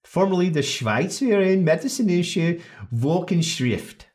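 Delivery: slow, careful German reading of Schweizerin Medizinische Wochenschrift; German pronunciation. Shown as a demonstration. Podcast host showing off the Swiss journal name, German pronunciation. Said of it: "for those of you who speak Swiss".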